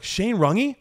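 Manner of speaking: high, rising incredulous question; astonished recognition. Retold or imitated. Fan hears the nephew is Shane, astonished. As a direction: blurt the name as a starstruck question.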